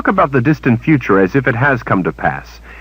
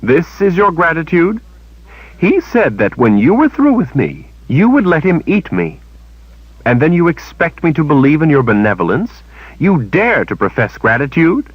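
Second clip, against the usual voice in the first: higher, indignant accusation; Kalen, accusing; 9 semitones higher, 3 dB louder than usual. A narrator's reading. Kalen accusing Shota over the attack, higher and angry. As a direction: hurl the charge back at her.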